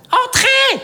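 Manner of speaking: high shouted call through the door; a sharp high yell; shouted entrance. Acted.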